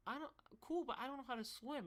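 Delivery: high, hesitant mock objection; acted squeaky reluctance; high-pitched protest. Retold hesitant reply to Joe.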